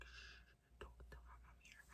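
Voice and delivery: very soft, hushed shushing; secretive whisper. Retold or imitated. Telling the friend to hide her presence, whispering. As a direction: whisper it so the caller can't hear.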